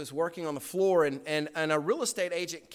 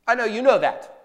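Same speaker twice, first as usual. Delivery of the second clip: higher and louder, pressing the point; preaching at full force; 8 semitones higher, 8 dB louder than usual. Acted. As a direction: drive it home to the congregation. Preacher rebuking head knowledge.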